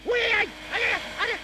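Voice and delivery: very high, loud squeals of laughter; shrieking laughter. Acted. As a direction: squeal as the dryer tickles you. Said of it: "la première fois que je t'entends rire".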